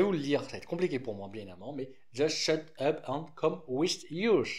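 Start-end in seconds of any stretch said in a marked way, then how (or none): 2.1-4.5 a French speaker reading a card name in laboured English; clumsy English accent. Shown as a demonstration. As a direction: try the English title, self-consciously awkward. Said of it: "mon accent anglais est vraiment nul à chier".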